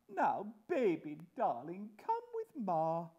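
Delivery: high-pitched grand cooing; doting mother. Acted. Mother calling baby to come along, doting. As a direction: fuss over the baby in a high voice.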